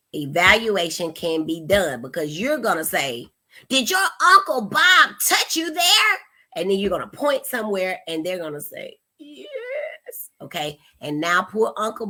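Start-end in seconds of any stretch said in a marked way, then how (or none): 3.7-6.2 high, loud, horrified mock interrogation; hammed-up alarm, pitched high and pressing; alarmed leading question. Untrained parent questioning child about Uncle Bob, alarmed leading tone. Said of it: "We wanna watch our tone". 9.2-10.3 tiny high, soft squeak; child's led answer. Acted. Child answering a parent's leading question, timid squeak.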